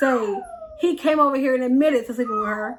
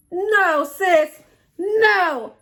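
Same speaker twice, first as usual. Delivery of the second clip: emphatic no; 6 semitones higher, 4 dB louder, much slower than usual. Retold or imitated. Friend reacting in disbelief.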